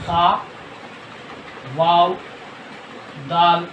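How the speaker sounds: खा, वाव, दाल said apart with pauses; spelling out letters. Shown as a demonstration. Teacher spells खुद for learners, letters said one by one. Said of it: "ये देखिए".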